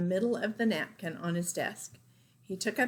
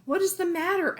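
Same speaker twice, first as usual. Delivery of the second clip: concerned father; 10 semitones higher, 5 dB louder than usual. Acted. Father voiced in story.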